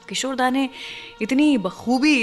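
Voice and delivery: usual speaking voice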